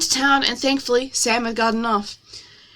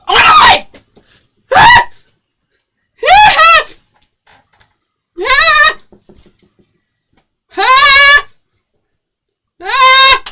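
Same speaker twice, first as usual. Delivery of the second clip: high piercing scream; shrieking ah; 10 dB louder, much slower than usual. Real, not acted. Screaming at chat over pie jokes. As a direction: shriek in mock horror.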